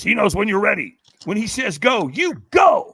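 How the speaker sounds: high, loud build to a shouted GO; retold urgent instruction. Mysterious guy hypes up a firewalker, rising to a shout. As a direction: mimic him, rising to a shout on the last words. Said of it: "he whispers in my ear, and he says".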